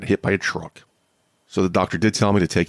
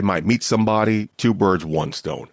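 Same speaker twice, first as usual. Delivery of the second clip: ordinary talk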